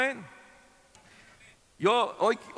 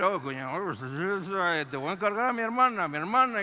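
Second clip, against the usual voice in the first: put-on slurred, rambling drunk talk; mock drunken mumbling; drunk brother-in-law. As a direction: imitate a tipsy in-law slurring a plea. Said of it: "lidiando con un borracho".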